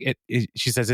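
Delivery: normal talking voice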